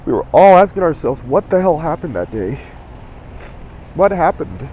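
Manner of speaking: real emotion rising, voice straining; pushing the words out through tears. Real, not acted. Remembering everyone's anger that day, choked up and straining. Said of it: "Ah, sorry".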